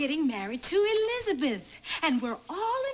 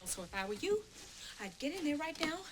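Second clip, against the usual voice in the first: much softer, controlled threat; hushed warning; 6 semitones lower, 12 dB quieter than usual. Acted. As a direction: drop to a near whisper to warn them. Mother warns children after outburst.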